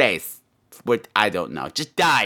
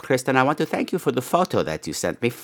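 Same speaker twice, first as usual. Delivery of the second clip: normal talking voice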